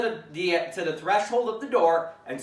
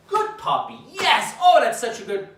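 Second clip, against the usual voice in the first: high, loud, gushing good puppy; the wrong excited praise to avoid; overexcited praise; 7 semitones higher, 6 dB louder than usual. Shown as a demonstration. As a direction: gush at the puppy like it's a party. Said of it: "A stimulated tone of".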